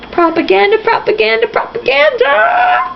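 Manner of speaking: rising chant of propaganda, ending in a high long shout; building mock shout. Real, not acted. Reader mocking the article with a rising propaganda shout.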